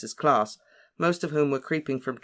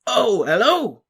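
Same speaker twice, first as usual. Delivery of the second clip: loud, higher cheerful hello; smiling; 9 semitones higher, 9 dB louder, slower than usual. A narrator's reading. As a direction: greet with a big smile.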